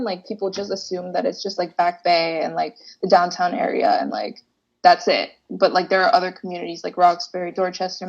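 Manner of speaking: ordinary delivery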